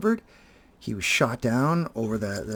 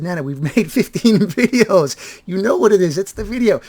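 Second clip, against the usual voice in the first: exasperated; 8 semitones higher, 9 dB louder than usual. Retold or imitated. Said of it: "I said".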